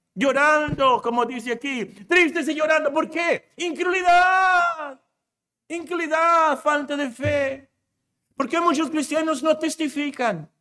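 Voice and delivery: rousing pulpit climax; fiery preaching. Real, not acted. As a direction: build to a ringing shout of the key word.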